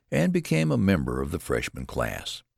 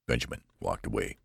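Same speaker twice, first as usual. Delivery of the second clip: ordinary delivery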